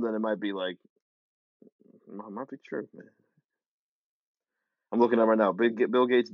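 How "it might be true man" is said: much softer, slow and calm; mock calm. Skeptic imagining a believable video narrator, calm and soft. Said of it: "if they sound calm".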